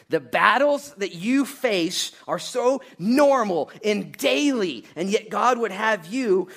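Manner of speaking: slow, high, loud preacher; preaching at full force. Real, not acted. Preacher to congregation about daily battles, slow full-force delivery. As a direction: hammer each phrase out to the crowd.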